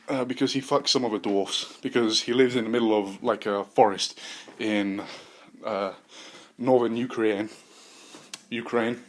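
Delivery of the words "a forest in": ordinary talk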